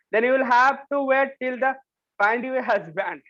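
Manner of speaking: slow, careful read-aloud for a class; slow story reading. Acted. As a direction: read each word out slowly for students. Teacher reads story slowly aloud. (कक्षा के लिए धीरे-धीरे कहानी पढ़ना)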